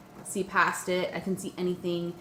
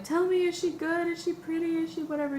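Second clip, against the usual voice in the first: eager questioning; 11 semitones higher than usual. Retold or imitated.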